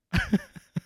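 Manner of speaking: squeaky giggle; high squeaky laugh. Real, not acted. Laughs at the fall.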